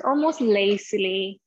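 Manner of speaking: normal talking voice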